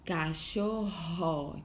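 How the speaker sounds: Cachorro slowly, ending held; slow, stretched. Shown as a demonstration. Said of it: "termina com esse som".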